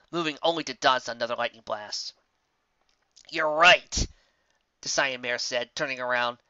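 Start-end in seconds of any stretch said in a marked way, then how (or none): 3.3-4.1 loud defiant. A narrator's reading. Rainbow Dash defiant.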